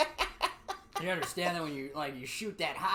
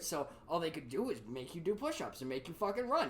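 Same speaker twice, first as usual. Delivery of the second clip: usual speaking voice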